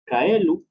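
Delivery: "Caelu" said loudly, the Latin diphthong voiced as a clear "ai"; Latin word read out. Shown as a demonstration. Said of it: "la palabra A E o el diptongo A E pasa como I".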